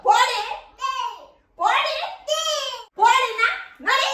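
shrill-free but high, loud shouts of get out; angry shout. Acted. Grandma shouting at granddaughter.

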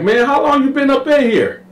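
high, strained voice; wary question in a high voice; suspicious high put-on voice; Carl suspicious. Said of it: "Carl like".

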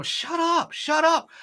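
high, hissed shut-up; hushing crowd. Acted. Crowd trying to silence the beggar, high. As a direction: pitch it up, snap it at him. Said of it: "Many people".

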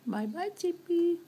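high cooing goodbye. Acted.

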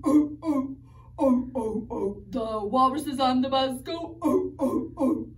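ordinary talk

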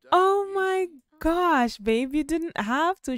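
high, loud, drawn-out gushing; mock touched delight. Sim thanking his fiancée for chocolates, playfully gushing. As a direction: play the thrilled boyfriend, hammed up. Voices Sim thrilled by gift.

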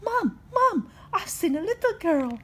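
high, loud breathless news; excited ghost. Acted. Little ghost runs to tell his mom, high and loud. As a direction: burst in calling for mom.

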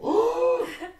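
sharp shocked high gasp; loud gasp. Retold or imitated. The crowd watching the sandwich hit, loud gasp.

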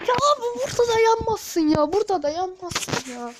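furious disbelief; exasperated yell. Real, not acted. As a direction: howl it at the unfair game. Exasperated outburst after dying.

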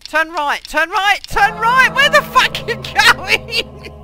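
frantic high shouting directions; urgent panicked yelling, pitch way up; being chased. Real, not acted.

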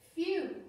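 loud, high sigh of relief; big relieved phew. A narrator's reading. Relief after vase caught.

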